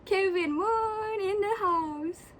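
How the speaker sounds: high, drawn-out announcement; fan hype. Real, not acted. Fan greeting an idol's picture, drawn-out hype. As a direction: stretch it slowly like a hype call. Hyping favourite idol's appearance.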